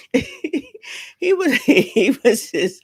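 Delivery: slow words broken by laughter; laughing. Real, not acted. Laughing at her own joke to viewers, words breaking up. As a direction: giggle through the restart.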